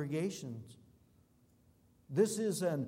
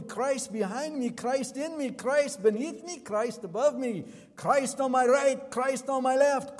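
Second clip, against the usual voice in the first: building from measured to loud, high, ringing proclamation; fervent recited prayer; 6 semitones higher, 8 dB louder than usual. Acted. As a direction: pray the breastplate like a rallying cry, swelling with each line. Recites Patrick's breastplate, building fervently.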